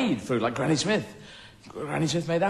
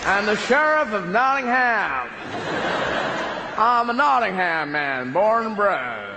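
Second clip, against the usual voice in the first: put-on American accent; slower than usual.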